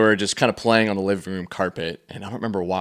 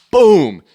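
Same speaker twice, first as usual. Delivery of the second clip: sudden loud, higher "boom" as the foot hits; 10 semitones higher, 14 dB louder than usual. Retold or imitated. Pastor telling of a kick, sudden loud boom. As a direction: punch the boom out like an impact.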